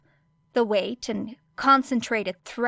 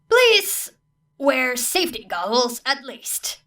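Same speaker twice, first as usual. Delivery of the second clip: higher, louder please; exasperated plea; 3 semitones higher, 5 dB louder, slower than usual. A narrator's reading. Teacher pleading with the students, exasperated. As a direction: beg them wearily.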